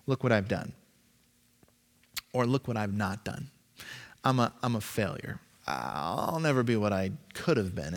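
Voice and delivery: a deflated voice of failure; self-pitying. Pretend. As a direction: sink into self-pity. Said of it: "self-pity".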